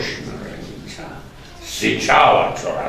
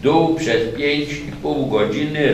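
plain reading voice

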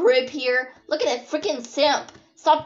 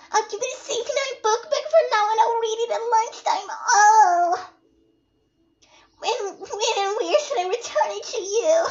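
put-on high squealing girlish character, bursts of loud delight; gushing Natsuki character; 10 semitones higher, 13 dB louder than usual. Game girl thanking someone for a book, high and gushing. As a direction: voice an excitable game heroine far above your own pitch.

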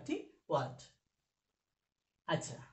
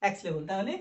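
normal talking voice

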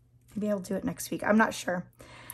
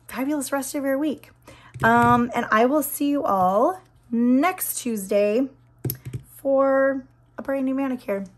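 ordinary talk

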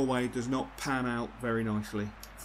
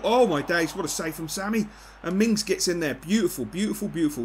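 higher and louder, thrilled at the play; excited commentary; 5 semitones higher, 5 dB louder than usual. Real, not acted. Gamer cheering his team's attack, excited. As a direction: cheer the move as it unfolds. Great save and goal chance.

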